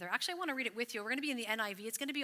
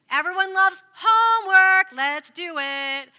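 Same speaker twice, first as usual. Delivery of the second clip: big hammed-up shout, high and loud; joking loud hype, then drawn out slow; mock cheer; 8 semitones higher, 18 dB louder, slower than usual. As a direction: sell the homework like a game-show host. Sarcastic homework pep shout.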